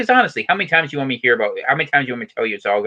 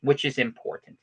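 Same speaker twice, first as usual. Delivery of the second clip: higher, punched announcer delivery with pauses; mock news report; 6 semitones higher than usual. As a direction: read it like a hammed-up local newscaster.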